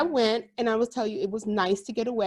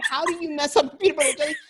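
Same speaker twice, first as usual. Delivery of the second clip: fast, exasperated question; a husband baffled by his son's complaint; 6 semitones higher, 4 dB louder, faster than usual. Retold or imitated. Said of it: "And my husband's like".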